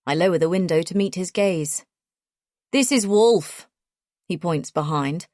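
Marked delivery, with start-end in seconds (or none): 2.7-3.7 light, confident Australian drawl; a hint of a confident Australian bloke; Australian accent. A narrator's reading. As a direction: voice him with an easy Australian twang. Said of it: "in his hard Australian accent".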